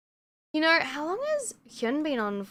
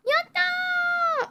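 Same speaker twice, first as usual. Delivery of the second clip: cheery yatta. Acted. Cheering to chat.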